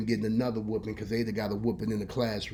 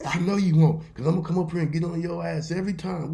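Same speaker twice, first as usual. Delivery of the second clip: higher, loud mock telling-off; scolding grandmother; 7 semitones higher, 6 dB louder, faster than usual. Granny scolding her grandson while whooping him, loud acted scolding. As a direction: lay down the law mid-whooping.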